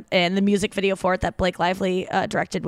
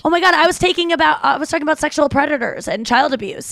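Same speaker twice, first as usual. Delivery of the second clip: flustered explaining; 7 semitones higher, faster than usual. Retold or imitated. Host replaying her panicked excuse to a fan, higher and fast. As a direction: rush the excuse like you've been caught mid-rant. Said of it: "and I go".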